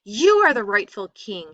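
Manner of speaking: loud, hot protest to Rama; angry brother. A narrator's reading. Brother protests to Rama, angry and loud. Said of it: "turned pale with anger".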